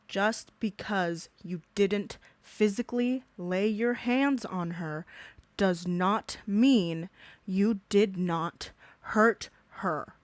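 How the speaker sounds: spelled out slowly, word by word; slow it right down for the slow ones; deliberate slow repeat to drive the point home; slow emphatic repeat. Shown as a demonstration. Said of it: "I had to repeat that for the dumbasses".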